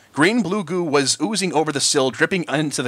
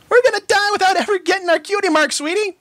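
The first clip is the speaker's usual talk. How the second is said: high loud despairing wail; doomed filly wailing; 3 dB louder than usual. A narrator's reading. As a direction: wail it, sure they're doomed. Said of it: "wailed Apple Bloom".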